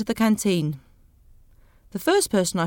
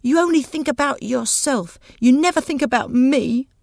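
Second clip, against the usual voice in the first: angry accusation; 5 semitones higher, 4 dB louder than usual. Acted. Daisy's furious accusation continues.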